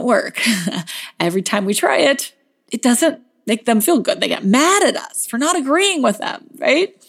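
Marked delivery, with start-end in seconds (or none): none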